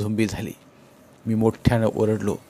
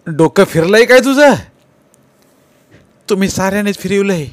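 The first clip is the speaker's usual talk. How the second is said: loud, high furious yell; angry shout; 9 semitones higher, 8 dB louder than usual. Acted. Tukaba yells angrily at Nana. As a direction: shout him down in anger. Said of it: "तुकाबा रागानं नानांना म्हणाला".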